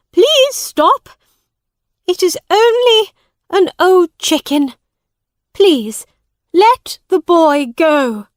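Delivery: high, loud urgent begging; desperate pleading. Acted. Woman to the judge, loud pleading. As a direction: beg to save the boy.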